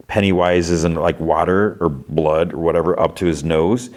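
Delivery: ordinary delivery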